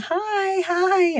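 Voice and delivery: put-on high, singsong little-boy hi; drawn-out, sweetly oblivious kid voice; little kid's greeting. Toddler strolls toward the frightened neighbor, imitated high singsong. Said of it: "Montgomery's walking towards me saying".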